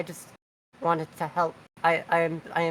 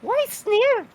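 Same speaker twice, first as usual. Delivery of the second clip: sudden high, loud disbelief; shocked why; 4 dB louder than usual. Real, not acted. Player shocked at the GM's choice, high yelp of disbelief.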